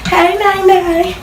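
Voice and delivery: high, drawn-out "Hey Nay Nay"; dance call. Shown as a demonstration.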